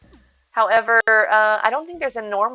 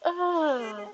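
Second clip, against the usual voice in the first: high, soft drawn-out yawn noise; imitated yawn; 6 semitones higher, 7 dB quieter than usual. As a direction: let out a mock yawn, high and quiet. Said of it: "I'm constantly yawning".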